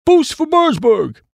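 very high, drawn-out reverent refrain; reverent echo. A narrator's reading. Other chappies echo the town motto, reverently. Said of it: "said the other chappies reverently".

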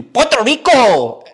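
loud, high, showy Spanish PUERTO RICO; mock an overdone Spanish accent; exaggerated Spanish accent. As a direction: suddenly switch into theatrical Spanish pronunciation. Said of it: "say it in the direct accent".

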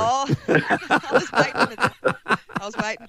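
laughing through the words. Real, not acted. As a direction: chuckle while you say it. Host teased, laughs through it.

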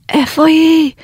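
high frantic yell of איפה היא; retold scream, pitched high and urgent; screaming at them. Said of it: "ואני מתחילה לצרוח עליהם". (צעקה היסטרית ומבוהלת)

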